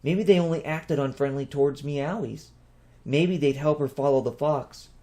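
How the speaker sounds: ordinary delivery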